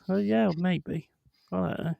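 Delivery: ordinary delivery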